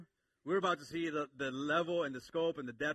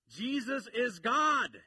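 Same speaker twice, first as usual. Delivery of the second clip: slow and louder, every word landed; emphatic declaration; 8 semitones higher, 8 dB louder, much slower than usual. Acted. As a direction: proclaim it slowly from the pulpit. Sermon climax declaration.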